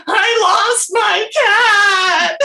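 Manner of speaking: high, very slow wail; hammed-up uncontrollable crying, every word stretched; mock bawling. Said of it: "uncontrollably bawling my eyes out".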